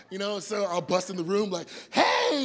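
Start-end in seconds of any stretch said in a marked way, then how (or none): 1.9-2.4 high, loud burst of a hello; imitating himself bursting into the bedroom with a loud hey; excited loud greeting. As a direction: barge in and greet the room full blast.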